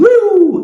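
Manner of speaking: apito agudo e alto como sirene; sirene de alerta. Retold or imitated. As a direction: soar como um alarme no peito. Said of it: "Ele fez assim". (o Espírito Santo apitando como sirene)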